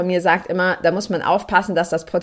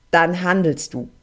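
ordinary talk

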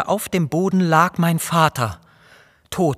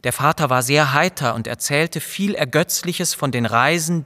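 usual speaking voice

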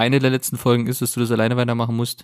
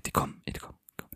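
frantic hushed muttering; breathless-sounding voiceless alarm; panicked whisper; 16 dB quieter, faster than usual. Acted. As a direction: drop to a whisper as they close in. Pursuers coming, hides on the phone.

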